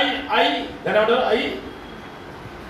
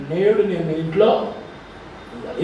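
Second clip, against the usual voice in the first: Jesus speaking; 3 semitones lower, 3 dB quieter, slower than usual. Acted. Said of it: "యేసు ప్రభు అన్నాడు".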